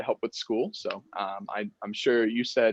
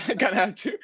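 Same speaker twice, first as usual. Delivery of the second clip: laughing; 9 semitones higher than usual. Real, not acted. Amused echo of customer's answer.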